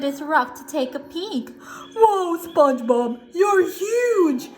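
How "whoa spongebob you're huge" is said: loud, high cartoon amazement; Patrick. Patrick mistakes the monster for his friend, amazed. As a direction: marvel loudly in a goofy character voice. Patrick marvels at huge figure.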